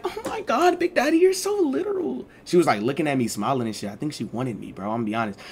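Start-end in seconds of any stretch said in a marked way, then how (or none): 0.0-2.3 high, flirty lady voice; giggly instructor. Retold or imitated. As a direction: squeal it like she's charmed.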